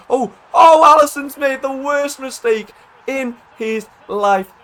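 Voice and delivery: high, loud "oh" drawn into slow disbelief; shocked commentary shout. Real, not acted. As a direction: erupt at a huge blunder, stretching the words out. Goalkeeper blunder hands him a goal.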